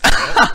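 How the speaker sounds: short amused laugh. Real, not acted. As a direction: crack up briefly. Amused by looping game line.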